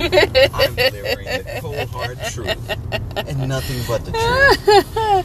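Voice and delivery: high, loud mock solemn oath; courtroom oath voice. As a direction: swear it like a witness.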